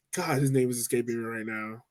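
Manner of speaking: usual speaking voice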